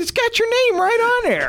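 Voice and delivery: very high-pitched retold exclamation; retold excited reply. Mentor retells showing the boy his gift certificate, excitedly high. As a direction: pitch it way up, re-enacting the reveal. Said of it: "And he looked, I said".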